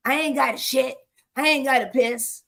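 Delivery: crude old man's blunt words, imitated; imitating demented resident. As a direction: toss it off like a rough, confused old man. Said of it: "this is how he speaks".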